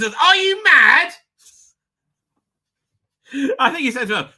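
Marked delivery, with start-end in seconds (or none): 0.0-1.1 high, loud, drawn-out disbelief; outraged dad. Retold or imitated. Dad reacting to engagement news, mock outrage. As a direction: imitate an appalled father. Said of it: "first thing he said to her was".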